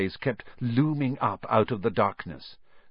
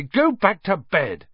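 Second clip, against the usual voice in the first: slow, high, angry order; furious; 8 semitones higher, 4 dB louder than usual. A narrator's reading. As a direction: order them off, weighting each word. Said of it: "said Ron furiously".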